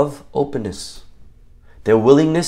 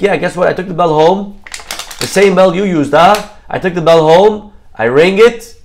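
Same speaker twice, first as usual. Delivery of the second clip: raised, higher voice of mock complaint; acted indignation; 5 semitones higher, 5 dB louder than usual. Poor man accuses rich man over bell, indignant. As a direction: lay out the grievance loudly, rising at each step.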